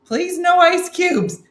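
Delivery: high, loud, drawn-out mock plea; all-caps pleading. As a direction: read it as if in capital letters. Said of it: "She put that in capital letters".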